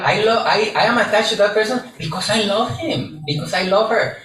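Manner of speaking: hammed-up lover making excuses; mock lovesick person. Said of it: "who has heard that one".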